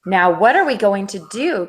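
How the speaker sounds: plain reading voice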